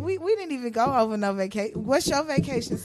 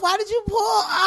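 incredulous shout; 10 semitones higher than usual. Real, not acted. Friend shouting at the storyteller over the army base story, incredulous. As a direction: yell it in real disbelief at your friend.

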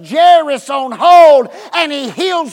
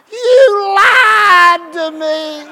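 mock sobbing kid; whiny child; 7 semitones higher, 5 dB louder, much slower than usual. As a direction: wail it like a let-down child. Whining child voiced in story.

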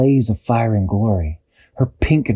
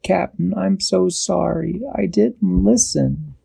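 high, slow, plaintive sorry; sorrowful apology; 8 semitones higher, slower than usual. A narrator's reading. Dashi apologises to the captain, high sorrowful voice. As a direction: draw out the apology in a small high voice.

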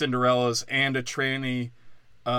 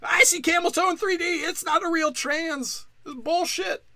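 very high, loud squealing gawker; excited viewer; 3 dB louder than usual. Acted. Moviegoer in 3D spotting the prince's crotch, high squeal.

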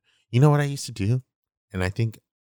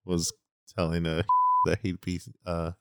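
normal talking voice

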